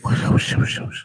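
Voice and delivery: voiceless hissy whisper sounds; imitated whispering. Imitating a church elder whispering to people, mock whisper. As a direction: imitate someone whispering in wordless hushes. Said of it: "Kalau ngomong bisik-bisik".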